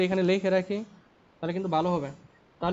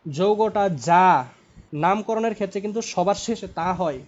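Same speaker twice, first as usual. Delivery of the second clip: loud, drawn-out opening, then steadier; emphatic reminder; 4 dB louder than usual. Shown as a demonstration. Teacher reminding a student of a naming rule, slow emphatic opening. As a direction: hammer the rule home, stretching the first words. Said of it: "খেয়াল করো".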